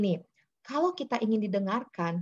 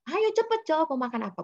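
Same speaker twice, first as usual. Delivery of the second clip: pushy parent; 7 semitones higher than usual. Shown as a demonstration.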